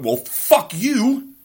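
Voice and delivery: high, loud and drawn-out slow fuck-you; mock angry retort. Dad imagining snapping back at anyone else, loud mock anger. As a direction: fling it back like you won the fight.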